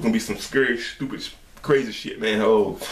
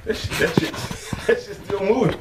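laughing disbelief; 9 semitones higher than usual. Real, not acted. Reacting to moving snake.

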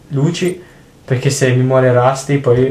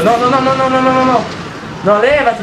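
panicked no's; 10 semitones higher, faster than usual. Real, not acted. Player in danger during game.

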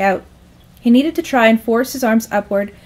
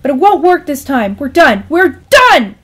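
loud, raised-pitch breakup shout; exasperated yell, building to a final blast; angry outburst; 5 semitones higher, 7 dB louder than usual. Acted. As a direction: end the call in a fury. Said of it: "with all the anger in her thumb".

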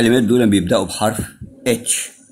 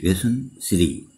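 slow, soft model; 2 semitones lower, 5 dB quieter, much slower than usual. Shown as a demonstration. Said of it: "teacher repeats the lesson title".

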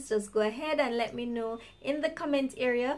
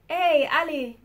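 calling out; 5 semitones higher, 5 dB louder than usual. Retold or imitated. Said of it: "someone is calling you".

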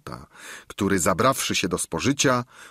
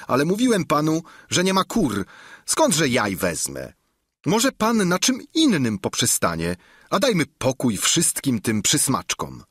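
lightly voiced eager innkeeper, a bit higher; a keen host boasting of his larder; hint of a bragging innkeeper; 6 semitones higher than usual. Innkeeper refusing again.

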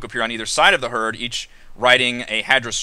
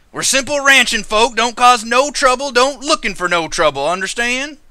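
put-on high, folksy ranch-country accent; firm warning in a high country rancher accent; 9 semitones higher, 5 dB louder than usual. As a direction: lay on a country accent, pitched up. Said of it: "His accent is".

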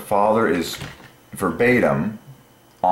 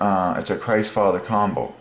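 ordinary delivery

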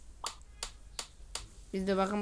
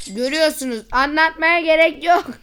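loud, high gleeful brag; excited; 8 semitones higher, 13 dB louder than usual. Real, not acted. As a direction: shout it out with delight. Gleefully showing money growing. (coşkulu, yüksek sesle övünme)

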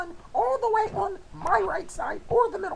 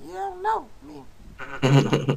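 slow drawn-out; 5 semitones lower, 3 dB louder, much slower than usual. Shown as a demonstration. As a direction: stretch every word out slowly. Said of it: "but shout it".

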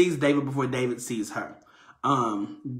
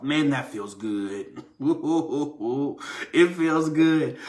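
relishing; slower than usual. Pretend. Savoring telling someone off.

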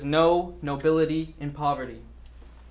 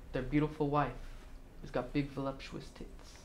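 low, hushed and controlled; very soft, low-pitched needling; quiet taunting; 7 semitones lower, 14 dB quieter than usual. Acted. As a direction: draw the room in with a soft, low voice. Acted speech drops to menacing calm.

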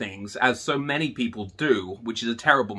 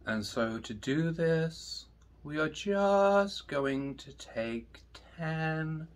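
guided meditation; 10 dB quieter, slower than usual. Acted. Teacher leading students through breathing, slow and soft. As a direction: guide them into calm, slowly.

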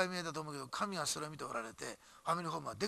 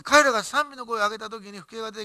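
rising preaching force; 5 semitones higher, 13 dB louder than usual. Real, not acted. Climax of sermon story.

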